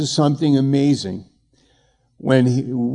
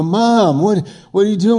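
up high and louder, taken aback; retold startled question; 7 semitones higher, 3 dB louder than usual. Son at the car window asking his mother, startled and raised. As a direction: sound caught off guard by your own mother.

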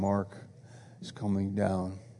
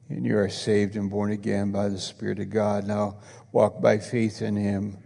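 ordinary talk, nothing marked

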